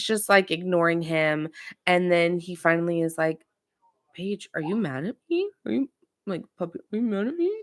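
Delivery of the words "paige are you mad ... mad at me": put-on high, soft babyish begging; imitating a boyfriend begging his girlfriend, softer; mock whiny plea. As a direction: whine it like a sulky boyfriend. Kyle begging Paige, calling her puppy.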